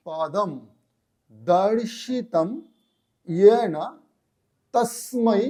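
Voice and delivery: emphatic word-by-word Sanskrit recitation with a pause after each; careful mantra recitation. Shown as a demonstration. Teacher reciting the mantra again for attentive learners, measured word-by-word recitation. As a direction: pronounce each word fully and firmly, spaced apart. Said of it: "এই মন্ত্রটা উচ্চারণ করছি".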